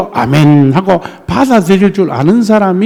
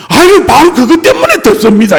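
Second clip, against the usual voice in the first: higher, louder, bright-voiced comeback; cheerful retort; 6 semitones higher, 5 dB louder than usual. A listener telling the pastor the dialect is why they listen, cheerful. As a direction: reassure the shy pastor eagerly. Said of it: "이러더라고".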